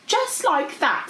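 high, slow, emphatic confirmation; dragon confirming. A narrator's reading. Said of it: "said Goldie".

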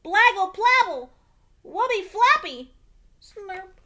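put-on high, loud baby babbling; toddler babble. Trixie babbles urgently at her daddy, high squeal. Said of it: "said Trixie again".

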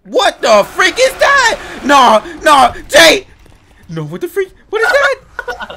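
high panicked shouting; scared yelling. Real, not acted. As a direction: yell in terror, half laughing. Panicked at monster.

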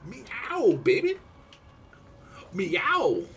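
high, falling cat calls; playful meowing. Pretend. Gamer greets player Meow, playful high cat calls.

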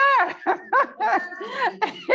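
high delighted laugh; burst of laughter. Real, not acted. Laughing at her own story with her friend, high laugh. Laugh after retold line.

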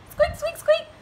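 very high little squeaks; high-pitched storybook mouse. Acted. Mouse answers the cowboy.

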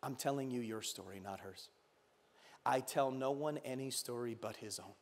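very low, hushed lion; large, deep voice. A narrator's reading. Lion answering the boy's question, hushed and deep. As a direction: speak softly and deep, with quiet authority. Lion's hushed reply in story.

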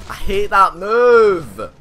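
loud, slow, drawn-out hate; slow angry yell. Real, not acted. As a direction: drag the words out in fury. Goro's move hits him.

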